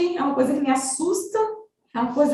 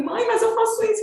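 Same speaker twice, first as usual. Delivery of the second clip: high-pitched imitation of a protesting son; retold protest; 9 semitones higher than usual. Son answers mother's nagging about chores, protesting in a high voice. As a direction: plead back like a child being scolded. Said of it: "ele sempre falava assim".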